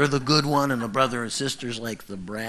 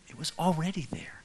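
breathy whisper, voice dropped; hushed awe; whispered emphasis; 5 semitones higher, 5 dB quieter than usual. Real, not acted. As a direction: whisper it like a secret joy. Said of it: "whispered point".

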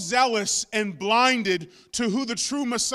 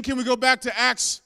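ordinary talk, nothing marked

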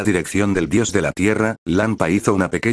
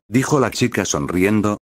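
ordinary delivery

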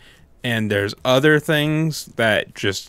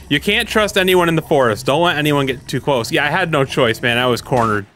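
ordinary talk

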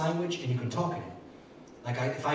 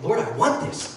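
higher, louder plea to God; hungry prayer; 9 semitones higher, 7 dB louder than usual. Retold or imitated. Preacher retelling his own plea to God, hungry prayer. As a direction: plead it eagerly, voice lifting. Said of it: "It's like, I was hungry".

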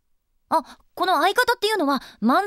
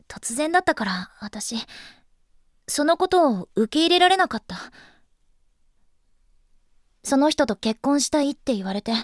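normal talking voice

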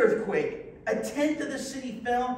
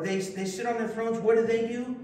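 ordinary delivery

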